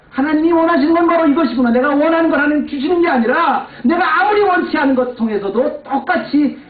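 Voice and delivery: high, loud proclamation; full-force preaching. Acted. Pastor declaring God's will to the congregation, loud preaching. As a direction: declare it to the whole room. Sermon conclusion climax.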